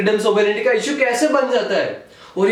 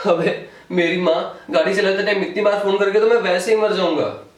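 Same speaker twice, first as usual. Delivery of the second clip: ordinary talk, nothing marked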